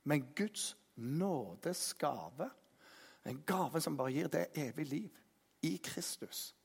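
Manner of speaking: tender grace. Acted. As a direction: let it fall gently. Preacher softens for grace. (mykt og ømt)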